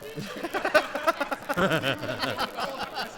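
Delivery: long high laugh; helpless laughter at the memory. Real, not acted. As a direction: laugh it off in disbelief. Laughing at own story.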